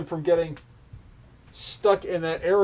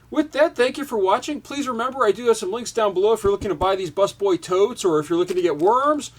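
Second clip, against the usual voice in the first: brighter, higher outro voice; upbeat sign-off; 6 semitones higher, 3 dB louder than usual. Acted. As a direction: wrap up cheerfully for viewers. Closing sign-off to viewers.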